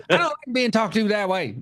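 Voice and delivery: high-pitched mock offended retort; hammed-up wounded pride; imitated offence. Acting out offended character's retort.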